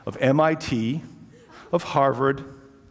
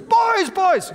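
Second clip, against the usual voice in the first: put-on much higher, calling out as an old gentleman; 4 dB louder than usual. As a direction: call the boys back from the doorway. Old host calls after boys.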